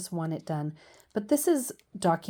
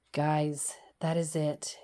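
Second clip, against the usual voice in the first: whisper; 3 semitones lower, slower than usual. Real, not acted. Whispered sign-off.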